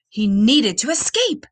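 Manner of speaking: usual speaking voice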